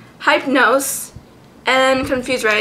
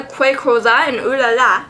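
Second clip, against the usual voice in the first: ordinary talk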